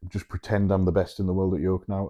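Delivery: ordinary talk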